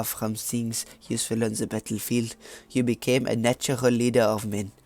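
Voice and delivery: put-on French accent, narrating. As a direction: read it out in a mock French accent. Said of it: "I'm gonna stop doing my French accent now".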